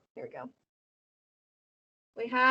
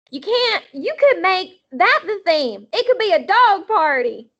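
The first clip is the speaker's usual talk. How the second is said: put-on much higher, bossy little girl; 7 semitones higher, 4 dB louder than usual. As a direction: boss them like a tiny kid. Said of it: "Sweetie Pie Thomas voice".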